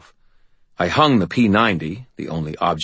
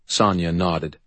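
usual speaking voice

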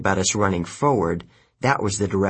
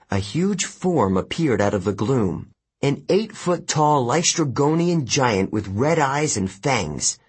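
usual speaking voice

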